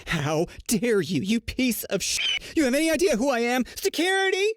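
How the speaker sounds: high Karen screech, climbing to the last word; mock screaming. Insulted mother calling casino security, mock screaming. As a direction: start slow and outraged, end in a shrieky mock call. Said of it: "Immediately starts screaming".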